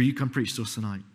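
ordinary talk

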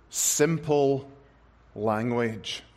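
ordinary delivery

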